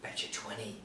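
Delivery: soft hushed aside; whispering. Acted. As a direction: lean in and whisper it.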